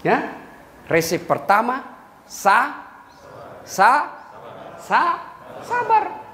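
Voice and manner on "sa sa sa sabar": drawn-out stammer. Acted. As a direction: stretch the stammered sa- sa- sabar slowly, rising high. Preacher teasing word sabar. (sabar diucapkan terbata-bata dan lambat)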